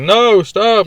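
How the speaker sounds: a hammed-up no, stop; Luke's mock cry. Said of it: "he's like".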